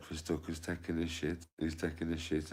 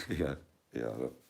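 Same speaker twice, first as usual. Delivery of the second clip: usual speaking voice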